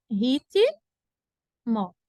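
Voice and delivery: "Ritmo" in separate chunks, long pause before the end; split into syllables. Shown as a demonstration. Teacher showing students how the word divides, syllable by syllable. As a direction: break "Ritmo" apart as rit, then mo. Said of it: "deve se separar assim".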